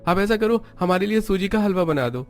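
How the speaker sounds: put-on high, boyish voice of a young brother-in-law; young boy's voice. (ऊँची, लड़कपन भरी देवर की आवाज़)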